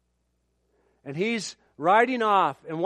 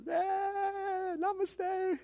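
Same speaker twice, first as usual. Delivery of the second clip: villager greeting; 14 semitones higher, 7 dB quieter, much slower than usual. Retold or imitated.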